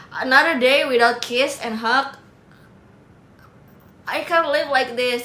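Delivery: slow, drawn-out melodramatic complaint; hammed-up despair, stretching the words; mock lament. Streamer bemoans having no kisses, in a mock lament.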